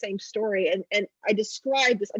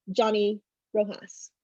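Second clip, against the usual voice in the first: ordinary delivery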